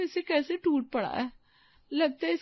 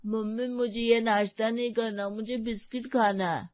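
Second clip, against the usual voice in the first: put-on lower, sulky small boy asking; child voice; 6 semitones lower than usual. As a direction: pout like a fussy little boy. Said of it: "भोलू जिद पकड़ लेता है". (बच्चे की ज़िद भरी आवाज़)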